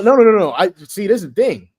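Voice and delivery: ordinary talk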